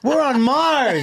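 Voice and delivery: high, drawn-out cry of exasperation; incredulous rant. Acted. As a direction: stretch each word in comic disbelief. Exasperated rant about technology.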